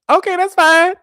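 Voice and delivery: loud, very high "fine by me"; exaggerated, high-pitched dismissal; mock-breezy retort. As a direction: fling it back high and loud. Acted retort to rejection over dog.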